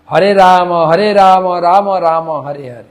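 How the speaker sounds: loud chant, রাম as "raa-mo"; open-ended Ramo. Shown as a demonstration. Teacher demonstrates one version, রাম as loud "Ramo". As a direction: sound the final o of রাম. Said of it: "রাম না রাম".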